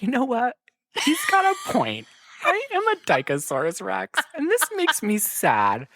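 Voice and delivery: a hint of a mopey revelation; mock Ricky voice. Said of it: "She's like".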